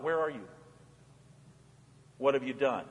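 soft searching call, not demanding; gentle call. Acted. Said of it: "He just calls out".

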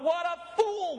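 high-pitched cry of disbelief; exclaimed scorn. Acted. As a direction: exclaim it like a judging onlooker. Acting out the crowd's scorn.

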